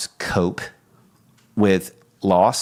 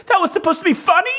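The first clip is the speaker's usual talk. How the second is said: much higher, fast, amazed question; incredulous wife; 4 dB louder, faster than usual. Retold or imitated. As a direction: squeal it like a delighted wife who can't believe it. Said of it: "she got the oddest look".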